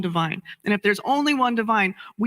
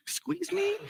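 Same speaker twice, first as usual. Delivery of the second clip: mock shock; 11 semitones higher, 8 dB quieter than usual.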